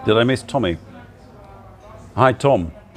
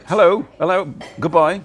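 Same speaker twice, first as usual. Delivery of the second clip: bright excited greetings; 8 semitones higher, 4 dB louder than usual. Real, not acted. Greeting viewers dropping in.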